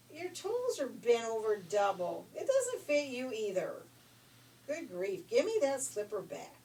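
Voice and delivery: loud exasperated scolding. Acted. Messenger scolds.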